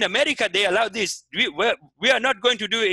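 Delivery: emphatic mock Nigerian accent; Nigerian preacher. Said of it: "he started teaching".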